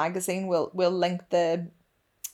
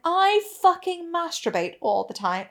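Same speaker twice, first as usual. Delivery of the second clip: higher, pitched-up mock boast; a hammed-up brag, pitched up; mock brash confession; 9 semitones higher than usual. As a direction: play the shameless oversharer. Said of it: "and be like".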